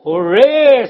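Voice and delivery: loud mock cheer of a happy crowd. Union workers cheering their rep's news, loud cheer. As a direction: shout it like a whole crowd celebrating. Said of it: "says the crowd".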